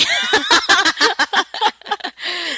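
bursting into a shrieking laugh; loud high laugh. Real, not acted. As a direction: let out a big squealing laugh.

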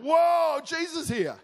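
sudden high gasp of surprise; startled whoa. Pretend. Churchgoer startled to find Jesus walking in the room, surprised whoa. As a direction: jolt at seeing Jesus in the room.

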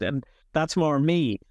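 usual speaking voice

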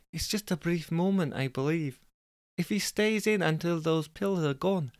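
high, lilting put-on Scottish woman; Jessica. Jessica divulging her problems to the petunias, high Scottish accent. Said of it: "We've decided she's Scottish".

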